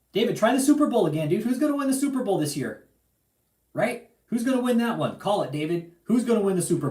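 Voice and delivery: high, loud mocking challenge to David; taunting shout. Real, not acted. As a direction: shout the dare up high to provoke him.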